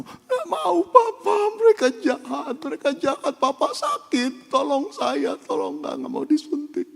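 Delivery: put-on high, loud, tearful child's begging; a panicked four-year-old pleading with papa; frightened child. Sick boy begs his father to stop the nurses, high and tearful.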